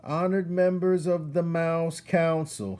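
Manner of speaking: loud, high formal announcement; shouted. Acted. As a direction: proclaim it across the council hall. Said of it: "shouted Furlow".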